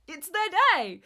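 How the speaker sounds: high, loud celebratory outburst; hammed-up shout of national celebration; mock excited cheer. Acting out Canada Day excitement.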